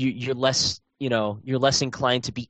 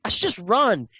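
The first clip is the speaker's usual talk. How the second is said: much higher, mocking his past self; pitched-up mock inner voice; mock self-scolding; 10 semitones higher than usual. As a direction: tease your own dumb idea in a high voice.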